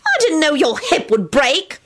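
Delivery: high, defensive protest; indignant, high excuse; squealed protest. A narrator's reading. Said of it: "Solange squealed and jumped".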